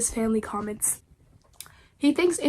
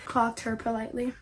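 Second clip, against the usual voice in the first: usual speaking voice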